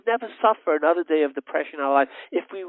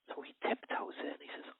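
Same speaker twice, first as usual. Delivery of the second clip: hushed whisper as he tiptoes in; storyteller's whisper; 18 dB quieter than usual. A narrator's reading. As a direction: breathe it like you're sneaking in. Tiptoeing into dark house.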